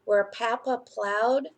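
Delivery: put-on lively storybook reading to children; picture book reading. A narrator's reading. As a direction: read it like a bedtime story for little ones.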